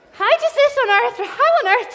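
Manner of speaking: high, loud puzzled outburst; exaggerated bafflement. Real, not acted. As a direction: burst out with the question. Mock-baffled question to audience.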